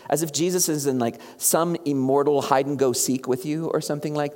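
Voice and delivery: ordinary delivery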